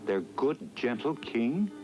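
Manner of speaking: ordinary delivery, nothing marked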